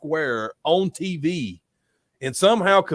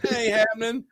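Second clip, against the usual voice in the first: much higher, cocky taunt; smug mock boss; 10 semitones higher than usual. Imagined Vince taunting whoever would blame him, smug voice. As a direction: sneer at the idea of going down.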